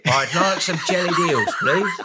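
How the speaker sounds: hammed-up jellied-eel customer; East End customer. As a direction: order politely in a put-on London voice. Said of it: "You go into the store and you say".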